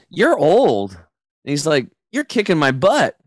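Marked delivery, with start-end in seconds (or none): 0.1-0.9 high, loud mock outrage; acted astonished accusation; incredulous son. Exhausted son's astonished accusation. 2.1-3.2 high-pitched imitation of a beaten son; acted astonished protest; incredulous complaint. Exhausted son's astonished complaint.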